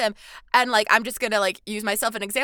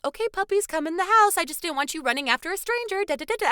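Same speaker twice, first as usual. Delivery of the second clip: sweet puppy talk; 8 semitones higher, faster than usual. Retold or imitated. Husband soothing the dogs.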